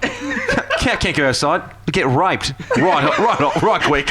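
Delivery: mock quote in Australian accent; Australian-sounding accent. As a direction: quote him with broad Australian vowels, half laughing. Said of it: "I know I do Australian".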